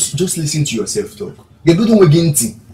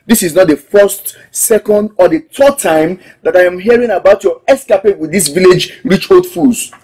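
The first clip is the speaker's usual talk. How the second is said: angry; 2 semitones lower, 8 dB louder than usual. Acted. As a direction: throw it at him, done with his lies. Woman ends affair, angry quarrel.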